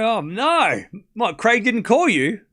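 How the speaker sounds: high, drawn-out sarcastic shock; exaggerated pretend horror; mock dismay. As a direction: feign outrage on a complainer's behalf. Mock outrage for the slighted chatter.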